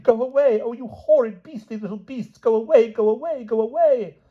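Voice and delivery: put-on very high shrieks at the boys; the queen raging at her sons; shrieking queen. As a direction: drive the horrid children off.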